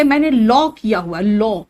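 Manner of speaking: लॉ with a rounded o, like "low"; Haryanvi pronunciation. Retold or imitated. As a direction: mimic Haryana round-mouthed लॉ. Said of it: "वो कहेंगे".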